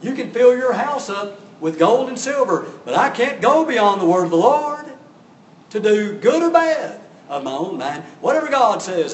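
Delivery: loud, high, pompous declaration; grandstanding. Acted. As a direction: act out Balaam making a show of piety. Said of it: "he kinda rears back and says".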